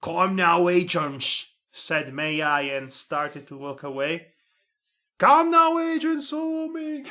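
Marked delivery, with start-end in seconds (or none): 5.2-7.0 much higher, breaking into a laugh; squeaky high redo. Acted. May I calls the agents again, now squeaky high and laughing.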